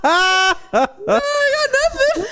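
high, slow words laughed out loud; laughing through the girlfriend's blank reply. Retold or imitated. Laughing as she mocks the girlfriend's blank answer.

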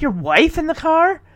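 mock being appalled, lifting at the end; appalled disbelief. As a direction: act out a scandalized question. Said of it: "they look at him like he's the most evil person".

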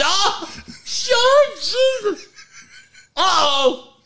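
high, laughing panic; hammed-up oops. Laughing at his own slip on air, high laughing uh-oh.

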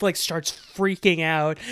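normal talking voice

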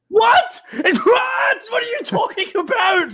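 very high wailing shout; shocked outburst. Acted. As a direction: cry out in hammed-up disbelief. Character shocked by the bad news.

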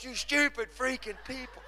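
very high mock-exasperated scolding; Pastor Mark. Senior pastor scolding Sam and Carolina, mock exasperated. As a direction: send the voice right up in comic outrage. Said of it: "Pastor Mark to Sam and Carolina sometimes".

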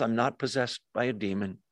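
soft, unruffled denial; Jesus, calm. Acted. Jesus replying to being called demon-possessed, calm. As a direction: answer evenly and softly, without rising to it. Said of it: "Just calmly says".

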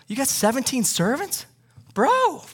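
high, incredulous bro voice; mock bragging rich guy. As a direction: play a boastful rich man, pitch shooting up on "Bro".

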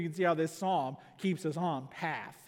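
ordinary talk, nothing marked

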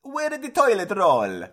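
little pope voice. Pretend. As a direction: play a fussy little pope. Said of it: "your little Pope goes".

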